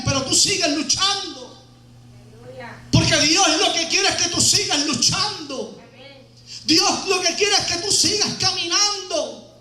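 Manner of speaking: full-voiced preacher's storytelling; preaching. Preacher tells the congregation about Bartimeo, preaching.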